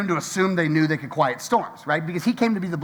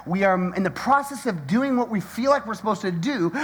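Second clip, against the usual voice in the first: ordinary talk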